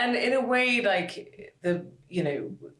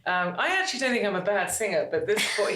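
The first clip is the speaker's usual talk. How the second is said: normal talking voice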